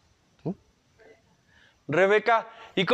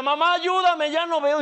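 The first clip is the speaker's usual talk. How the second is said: put-on scared child; 10 semitones higher than usual. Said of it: "Y el niño camina".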